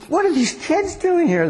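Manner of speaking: hammed-up high Pharisee scandalised by children in the temple; indignant Pharisee. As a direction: squeal out the outrage like a scandalised elder.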